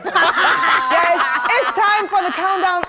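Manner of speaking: loud, slow, swaggering host intro; cocky voice. Acted. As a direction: hype it up like a big shot. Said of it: "That's my cocky voice out".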